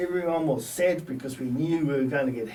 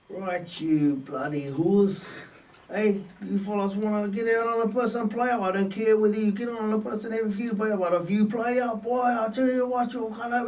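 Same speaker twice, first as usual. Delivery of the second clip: mock stern coach, higher and raised; coach's angry telling-off; 6 semitones higher than usual. Coach scolding hungover players, mock angry. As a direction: bark it like a furious coach scolding the team. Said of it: "he goes".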